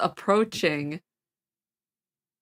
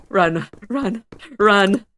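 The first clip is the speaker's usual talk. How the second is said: yelling while running away; 8 dB louder, much slower than usual. Real, not acted. Fleeing in action game segment.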